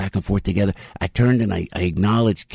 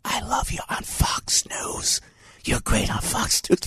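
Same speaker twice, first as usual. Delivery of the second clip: slow, voiceless stage whisper; secretive whisper; 2 semitones higher, 5 dB quieter, slower than usual. Retold or imitated. Fan confiding love for Fox News appearances, slow whisper. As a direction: whisper it like a forbidden confession. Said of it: "Then he whispers. He whispers".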